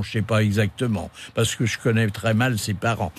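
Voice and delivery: ordinary talk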